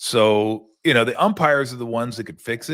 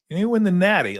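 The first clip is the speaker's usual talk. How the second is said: higher mock old-timer saying natty; mocking old guy; 9 semitones higher, 3 dB louder, faster than usual. Host mocks old men using slang, in a higher teasing voice. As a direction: lightly parody the try-hard old guy. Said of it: "when old guys like me say natty".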